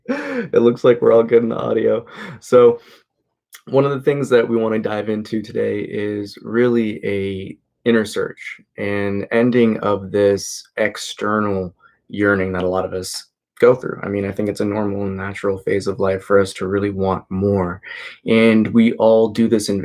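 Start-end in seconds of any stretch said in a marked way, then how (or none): none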